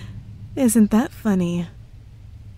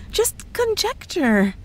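coy innocence; 11 semitones higher, slower than usual. Acted. Coy denial after a threat.

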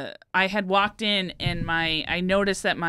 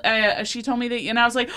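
ordinary delivery

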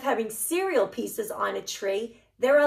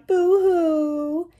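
higher, hammed-up boo-hoo sob; mock crying; 7 semitones higher, 5 dB louder than usual. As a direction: pretend to cry for the kids. Letters about to cry.

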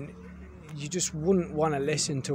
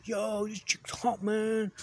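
high mock dude voice, much higher; mocking bro voice; 9 semitones higher, slower than usual. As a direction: ape a clueless bro drooling over a woman. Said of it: "And then he's like".

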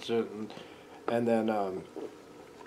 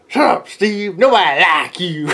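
higher, louder taunting yell; heckler yell; 5 semitones higher, 9 dB louder than usual. Acted. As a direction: jeer at him from the stage. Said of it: "and says".

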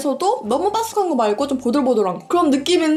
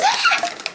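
delighted gasp. Real, not acted. Reacts to smell, laughing gasp.

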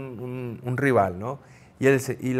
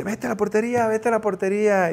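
higher, urgent order; imitating the coach; 8 semitones higher than usual. Coach sends the scoring goalkeeper back to his goal, mock order. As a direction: bark it like a coach sending him back. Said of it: "Y me dice".

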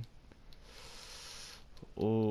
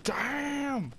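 high-pitched, drawn-out yell; shocked exclamation. Real, not acted. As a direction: cry out in sudden shock. Exclaims at game event.

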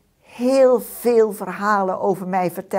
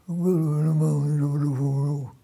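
low, fast voice from underground; mole voice from deep; 7 semitones lower, faster than usual. A narrator's reading. Mole refusing from the depths of the hole, low. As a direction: grumble it from deep below. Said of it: "hoor ik uit de diepte".

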